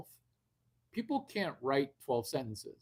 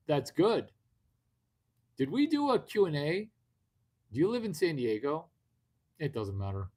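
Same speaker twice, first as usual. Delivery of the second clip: ordinary talk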